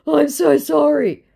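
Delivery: high, slow pleading whine; mock the scammer's sorry; whiny mock sorry. Scammer begging.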